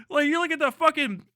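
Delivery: usual speaking voice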